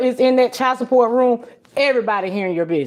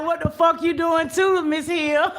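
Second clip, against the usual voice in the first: high laughing mock outrage at a viewer; laughing mock question; 8 semitones higher, 3 dB quieter than usual. Streamer laughingly teasing a viewer about her super sticker, high and amused. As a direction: tease it out through laughter, pitched up. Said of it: "I was like".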